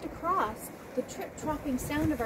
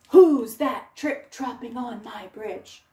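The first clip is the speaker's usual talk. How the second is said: gruff troll; 8 dB louder, slower than usual. Acted. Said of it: "and said".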